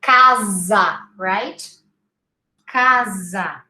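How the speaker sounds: Casa in Portuguese as KAH-za; Casa with a buzzing Z; Z sound. Shown as a demonstration. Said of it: "the S becomes a Z sound".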